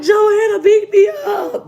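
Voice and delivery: put-on slow, loud, wailing little-kid cry; mock crying. Little girl wailing to her sister, slow hammed-up crying. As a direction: wail it like a beaten kid, half laughing. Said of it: "Boo crying".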